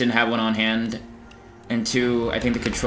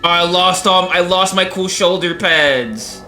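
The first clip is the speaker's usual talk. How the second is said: mock whine; 7 semitones higher, 5 dB louder, slower than usual. Real, not acted. Whining over lost gear.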